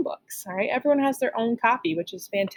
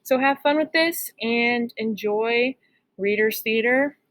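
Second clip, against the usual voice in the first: ordinary delivery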